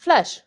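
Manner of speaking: "Flèche" with è as open "eh"; French pronunciation. Shown as a demonstration. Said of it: "word that uses an accent grave".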